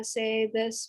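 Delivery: usual speaking voice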